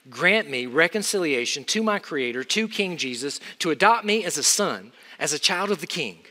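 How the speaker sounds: ordinary delivery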